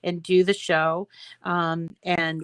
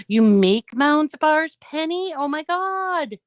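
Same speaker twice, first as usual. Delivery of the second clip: high, gasping real surprise; delighted amazement; 8 semitones higher, 5 dB louder, slower than usual. Real, not acted. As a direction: react with a gasp, drawing the words out high. Reacting to a viewer's comment.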